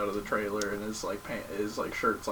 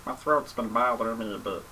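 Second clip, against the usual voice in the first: Grover voice. Pretend. Doing a character voice.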